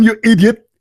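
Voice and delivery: a preacher rebuking a critic; mocking Zakir Naik. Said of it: "I said to him".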